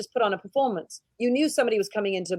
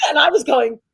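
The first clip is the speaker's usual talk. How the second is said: usual speaking voice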